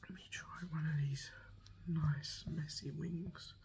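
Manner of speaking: ASMR eating whisper; hushed food-review whisper. Real, not acted.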